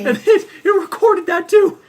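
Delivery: laughing while talking; words break up in laughter. Real, not acted. Shingle flew at her.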